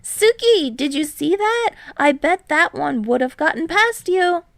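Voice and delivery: high, loud excited yell. A narrator's reading. Hinata yelling to Kei after a spike, high excited yell. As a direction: yell it across the court, grinning. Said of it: "he yells only to Kei".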